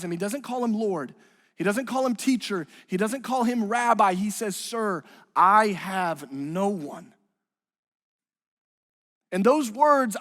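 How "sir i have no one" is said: drawn out word by word, lightly acted; slow, weighty plea. Paralyzed man tells Jesus he has no one, slow plea. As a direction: let each word of the imitated plea hang. Preacher voices the man's lonely plea.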